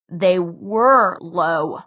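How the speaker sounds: slow low growl through tension. A narrator's reading. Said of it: "Jace growled".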